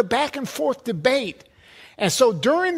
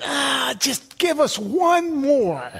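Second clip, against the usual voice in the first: higher, drawn-out mock plea; mock pleading; 5 semitones higher, much slower than usual. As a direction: beg slowly, never satisfied, hamming it up. Mimics sign-seekers never satisfied.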